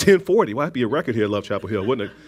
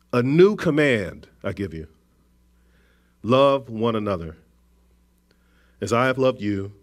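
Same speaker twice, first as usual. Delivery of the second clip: scripture reading; 2 semitones lower than usual. Acted. Preacher reading Jesus's words to the congregation, measured. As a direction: read it as the words of Jesus, unhurried.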